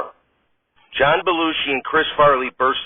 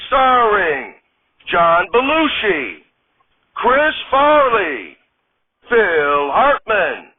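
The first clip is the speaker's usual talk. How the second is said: slow, booming show announcer; live TV announcer; 10 semitones higher, 3 dB louder, much slower than usual. Acted. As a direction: announce each name, stretched out.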